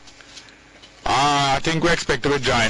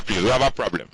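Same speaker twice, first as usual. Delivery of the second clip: ordinary delivery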